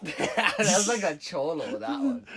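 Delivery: laughing through the words; cracking up while talking. Real, not acted. Laughing at the impression.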